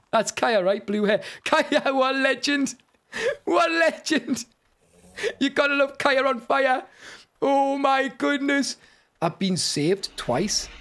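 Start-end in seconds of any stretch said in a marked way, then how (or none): 1.4-8.8 high, excited cheering; elated praise. Real, not acted. Player praising friend who saved their life, elated cry. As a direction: cheer the rescuer in amazement.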